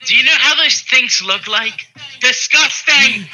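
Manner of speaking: loud, high revulsion; high-pitched, appalled yell; disgusted outburst. Real, not acted. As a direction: shout it in revolted protest. Revolted at idea of water rats.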